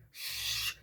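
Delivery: soft drawn-out "shh"; imitated quiet shushing; soothing shush. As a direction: hush someone who is panicking. Comedian hushing the screamer.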